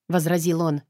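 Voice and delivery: ordinary delivery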